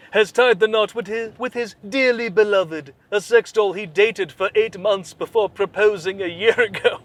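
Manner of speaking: mock anchor voice, rising into the story; hammed-up newscaster read; news read.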